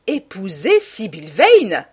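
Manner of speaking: stunned, drawn-out outburst; astonished cry. A narrator's reading. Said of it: "s'écria lord Henry". (lord Henry s'écrie, stupéfait)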